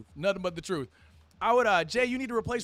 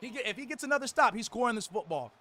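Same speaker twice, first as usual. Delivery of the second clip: excited broadcast commentary; play-by-play; 4 semitones higher than usual. Acted. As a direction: call the game like a TV announcer. Live play-by-play of a game.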